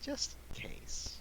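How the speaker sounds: whisper. Real, not acted. Joking aside after a laugh.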